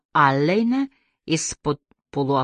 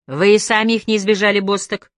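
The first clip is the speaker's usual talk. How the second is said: fast, louder smirking jab; 4 semitones higher, 5 dB louder than usual. A narrator's reading. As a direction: needle her with a grin. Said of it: "ухмыльнулся". (быстрая насмешливая колкость)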